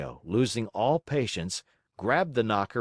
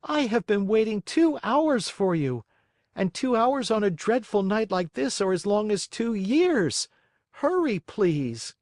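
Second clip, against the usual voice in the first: higher, slightly louder pleading; pleading Pinocchio; 11 semitones higher, 3 dB louder than usual. Acted. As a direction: urge the snail to hurry.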